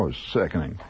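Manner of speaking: ordinary delivery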